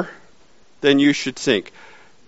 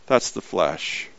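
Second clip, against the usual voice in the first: soft lower echo; 8 semitones lower, 9 dB quieter, slower than usual. Shown as a demonstration. Preacher repeating his point to the church, softly. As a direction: lower and much softer, slowly.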